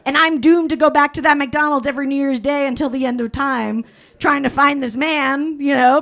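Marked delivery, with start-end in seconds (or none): none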